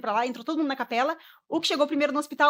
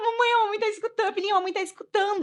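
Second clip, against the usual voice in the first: emotional calling out; 10 semitones higher, 4 dB louder than usual. Retold or imitated. Mother calling to crying newborn.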